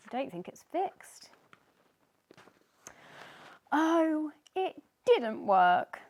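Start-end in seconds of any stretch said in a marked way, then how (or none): 3.7-6.0 louder, slow miserable wail; mild hammed-up misery; miserably. Sneezy after the failed cure, slowly miserable. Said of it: "said Sneezy miserably".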